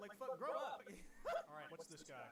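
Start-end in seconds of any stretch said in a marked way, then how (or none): 0.9-1.5 short, very high laugh; real laugh. Real, not acted. Streamer amused after a mock rant, high laugh. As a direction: crack up briefly, pitched way up.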